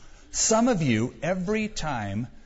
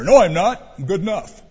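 defiant denial; 5 semitones higher, 9 dB louder than usual. Acted. Sinner voiced refusing.

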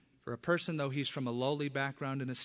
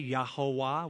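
ordinary talk

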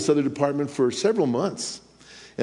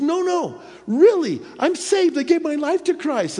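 very high, eager pleading; earnest insistence; 12 semitones higher, 4 dB louder than usual. Retold or imitated. Coworker insists her conversion is real, very high eager. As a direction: insist it is true. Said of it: "And she goes".

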